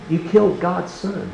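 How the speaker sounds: stern accusation. Acted. Preacher as Peter accusing the listeners, slow and pointed. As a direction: lay the blame on them, slowly and high.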